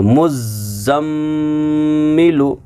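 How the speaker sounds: मुज़म्मिलु slow, with long nasal m; correct ghunna reading. Shown as a demonstration. Teacher rounds off the correction, मुज़म्मिलु with held ghunna. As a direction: double the z and hum the m in मुज़म्मिलु. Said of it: "सही तरीका है".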